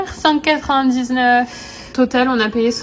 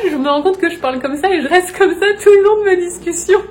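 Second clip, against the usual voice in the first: high and loud, bubbling over; excited; 6 semitones higher, 5 dB louder than usual. Real, not acted. Vlogger excitedly telling Ri about her camera habit, high and loud. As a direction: tell it fast and high, thrilled at the discovery.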